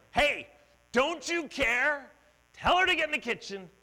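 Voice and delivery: loud, high, indignant bark at Jesus; barking complaint. Retold or imitated. Martha barking at Jesus, loud and indignant. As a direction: snap at him like a fed-up host. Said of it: "barking at him".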